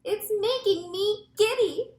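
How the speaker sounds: giddy, high-pitched plea; calling out. A narrator's reading. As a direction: wail it plaintively from up high. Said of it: "Miss Spider called out".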